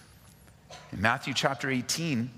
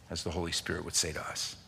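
ordinary delivery

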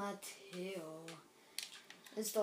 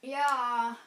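loud shout; 4 semitones higher, 12 dB louder than usual. Real, not acted. Cheering during game.

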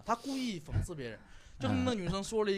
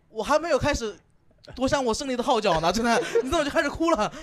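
higher, louder, put-out protest; mock outrage at her tears; mock indignant complaint; 8 semitones higher, 7 dB louder than usual. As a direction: act the cheated winner of the argument. Mock indignant boyfriend in quarrel.

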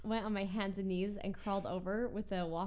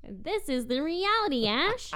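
loud, high imitation of his line; mock lecture; 7 semitones higher, 8 dB louder than usual. Girlfriend imitating boyfriend's catchphrase, loud and hammed-up. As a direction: ham up his catchphrase at Ash. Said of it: "He loves to say that".

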